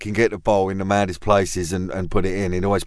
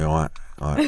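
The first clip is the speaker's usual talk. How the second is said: casual nod of a greeting; understated cool all right; playing it cool; 2 semitones lower than usual. Two famous men greeting, playing it cool. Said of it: "acknowledgement of two famous people going".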